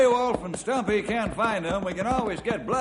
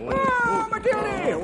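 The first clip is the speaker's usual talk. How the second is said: calling out; 14 semitones higher, much slower than usual. Acted. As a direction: pull the horse up with a shout. Reining in the horse.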